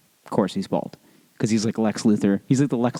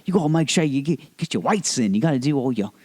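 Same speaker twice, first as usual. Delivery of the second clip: higher-pitched, upbeat mock Australian; positive Australian trainer; 4 semitones higher than usual. Trainer urging client to lift weights, upbeat Australian. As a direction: coach cheerily in a put-on Australian accent. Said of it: "always, like, positive, positive".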